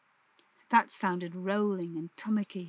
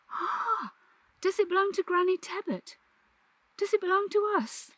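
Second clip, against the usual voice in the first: put-on high, wondering girl's voice; excited child; 10 semitones higher than usual. Catherine excitedly asks her father if the hill belongs to them, high and eager.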